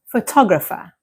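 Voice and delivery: photographer stressed on TOG; careful model photographer, beat on TOG; second-syllable stress. Shown as a demonstration. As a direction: hit the second part, pho-TOG-rapher. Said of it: "the stress moves to the second part".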